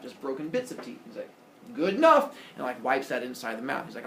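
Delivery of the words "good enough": high, loud "good enough"; breezy acceptance. Retold or imitated. Epimetheus settling for broken bits of teeth, breezy.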